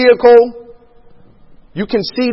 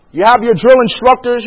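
ordinary talk

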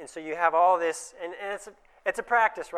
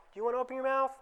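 much higher, pleading question on mouth; higher and softer questioning tone, the way not to ask; coaxing question; 8 semitones higher, 3 dB quieter, much faster than usual. Shown as a demonstration. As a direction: ask it sweetly as the wrong way. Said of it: "Don't say".

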